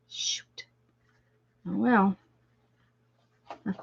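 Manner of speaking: under-breath whisper. Real, not acted. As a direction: hiss it to yourself. Mild curse after hand slips.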